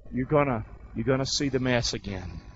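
usual speaking voice

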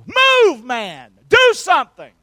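high, loud shout to move; shouted command. Acted. Pastor urging congregation, shouted high command. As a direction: bark it like a coach. Preacher urging people to act.

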